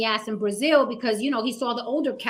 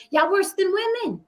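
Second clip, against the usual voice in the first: higher, pointed jab; indignant callout; 7 semitones higher than usual. Real, not acted. Host scolding the men on the panel, rising indignation. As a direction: call them out, first time.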